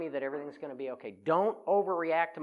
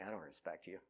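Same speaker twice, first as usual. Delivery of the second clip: judgy aside; 8 semitones lower, 15 dB quieter than usual. Retold or imitated. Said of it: "I'm like".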